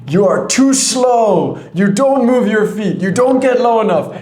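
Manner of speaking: forceful, high-pitched mock yelling; shouting coach. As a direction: imitate a coach hollering corrections across the court. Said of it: "one of the coaches saying to me".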